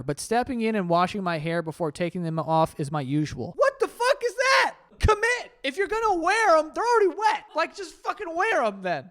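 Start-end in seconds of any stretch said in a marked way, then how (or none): 3.6-9.1 outraged shout. Real, not acted. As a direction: yell at the absurdity. Outraged at socks in shower.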